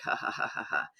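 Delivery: soft breathy ha-ha, barely voiced; a gentle warm-up chuckle, almost under the breath; gradient laughter. Shown as a demonstration. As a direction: ease into a quiet, tentative laugh. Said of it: "start with gradient laughter".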